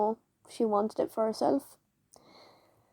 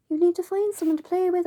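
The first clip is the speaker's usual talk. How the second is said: bossy teacher; 8 semitones higher, 3 dB louder, faster than usual. Retold or imitated. Teacher insists child play.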